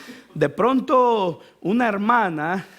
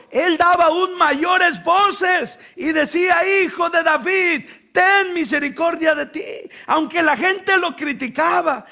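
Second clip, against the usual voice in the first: fiery preaching; 4 semitones higher than usual. Acted. Preacher urging the congregation, shouting with force.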